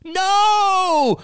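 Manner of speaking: very high, loud shrieking no; toddler scream. A narrator's reading. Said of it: "screamed Ben".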